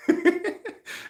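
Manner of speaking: short high giggle; laughing. Real, not acted. Vlogger amused at getting a ticket, high short laugh. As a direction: giggle at your own successful fib.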